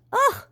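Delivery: loud, high-pitched grunt. A narrator's reading. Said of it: "he grunted".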